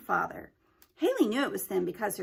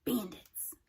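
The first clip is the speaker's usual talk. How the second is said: soft unvoiced whisper; whispered reveal; 5 dB quieter than usual. A narrator's reading. Haley whispers the decoded clue to herself. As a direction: breathe the answer like a secret.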